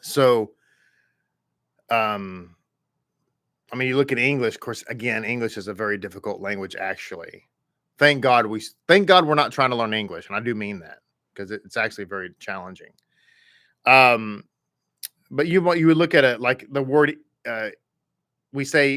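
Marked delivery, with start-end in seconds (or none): none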